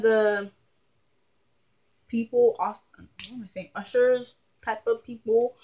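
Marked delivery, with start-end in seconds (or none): none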